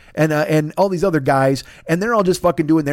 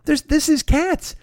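exasperated disbelief; 10 semitones higher, slower than usual. Retold or imitated. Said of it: "And I'm just like".

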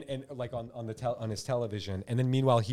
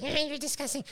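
high squeaky mocking voice; chipmunk sneer; 13 semitones higher than usual. Comedian imitating the daughter's squeaky insult again. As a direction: pitch it way up like a chipmunk. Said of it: "she's like".

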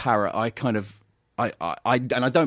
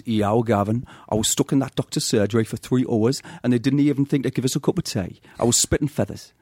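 a light Geordie accent, nan's phrase. Shown as a demonstration. A Geordie nan complaining to Gavin, light Geordie accent. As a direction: Geordie voice drawling the nan's line. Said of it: "How can I get the Geordie accent".

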